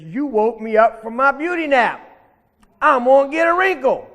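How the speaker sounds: indignant bully. Acted. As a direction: scold like an offended, pampered alligator. Bully alligator scolds rabbit for waking him.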